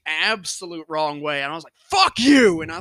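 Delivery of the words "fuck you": loud snapped fuck you; angry retold yell. Throwing the marker at a classmate, a loud angry yell. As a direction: hurl it back, loud and offended.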